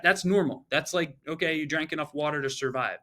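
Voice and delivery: normal talking voice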